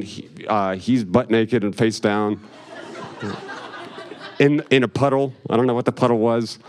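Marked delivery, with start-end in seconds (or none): none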